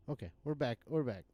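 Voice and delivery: ordinary talk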